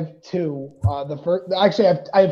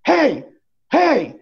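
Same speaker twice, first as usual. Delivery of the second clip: retold shout; 10 semitones higher, 5 dB louder than usual. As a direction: shout down a talker in the theater. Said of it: "stood up ... and went".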